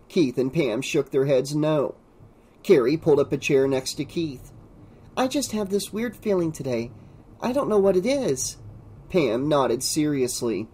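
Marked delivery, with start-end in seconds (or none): 5.2-8.6 put-on higher, slightly softer girl's voice; higher voice confiding unease; uneasy girl character. A narrator's reading. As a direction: voice an uneasy schoolgirl, pitched up. Narrator voices Carrie's uneasy remark.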